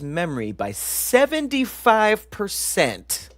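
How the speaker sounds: slow, loud, high shout of "seventy-five percent"; hyped shout. Real, not acted. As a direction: belt the number out in amazement. Hyped reveal of stat.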